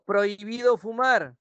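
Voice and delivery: high, slow, firm order; modelled prohibition. Shown as a demonstration. Teacher models a no-smoking order, commanding tone. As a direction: lay down the rule slowly. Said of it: "Eso es una orden".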